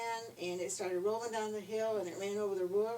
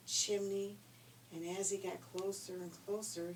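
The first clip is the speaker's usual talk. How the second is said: usual speaking voice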